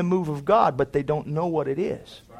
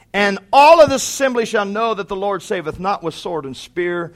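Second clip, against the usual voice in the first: loud, raised preacher's cadence; preaching; 5 semitones higher, 7 dB louder than usual. Acted. Preacher reading scripture to the congregation, loud and raised.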